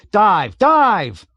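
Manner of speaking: very loud, high screamed commands; screamed order. A narrator's reading. Bombardier screams at the pilot to dive. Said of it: "to Makwat he screamed".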